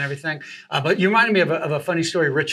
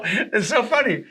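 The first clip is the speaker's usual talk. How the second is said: ordinary talk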